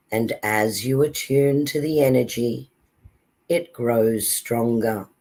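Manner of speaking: slow, calm meditation voice; calm grounding exercise. Acted. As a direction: guide them gently into calm. Leads a meditation.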